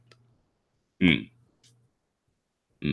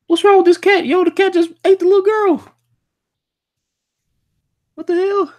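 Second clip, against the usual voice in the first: very high, loud shrieking disbelief; shocked shriek; 8 dB louder than usual. Real, not acted. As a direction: scream it out, horrified. Cat eats the girl.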